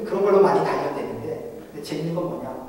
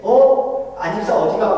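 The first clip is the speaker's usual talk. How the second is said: higher, louder surprised greeting; surprised call; 5 semitones higher, 9 dB louder, slower than usual. Retold or imitated. 시장 입구에서 지나가는 집사를 부르는 친구, surprised call. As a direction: call out in surprise to a passing friend. Market friend calls out surprised.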